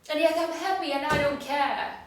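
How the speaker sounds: defiant shrug of a line, turned up; bigger dismissive. Shown as a demonstration. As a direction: toss the line away harder, pushed further.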